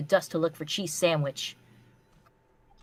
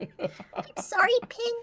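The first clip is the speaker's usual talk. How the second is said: high, slow, squeaky pony plea; 4 dB quieter, much slower than usual. Acted. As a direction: whine it like a cartoon pony. Voicing character in fanfic reading.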